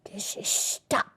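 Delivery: whispered, slow hiss. A narrator's reading. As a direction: hiss it like a sneaking thief. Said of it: "the old Grinchy Claus hissed".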